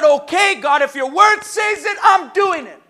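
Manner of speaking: loud vow of obedience; shouted vow. Acted. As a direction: cry it out like a promise. Voicing an obedient prayer to God.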